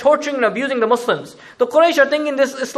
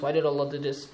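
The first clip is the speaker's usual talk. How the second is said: the question dropped much softer; fading, almost to himself; 8 semitones lower, 11 dB quieter than usual. Shown as a demonstration. Believers asking themselves, fading to soft. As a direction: let the why die away quietly. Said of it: "keep asking themselves the question".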